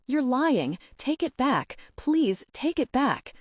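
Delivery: high, pleading, slowing at the end; a girl pounding her friend's chest, begging; desperate plea. A narrator's reading. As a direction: beg him to take it back. Lunging at him, pounding fists.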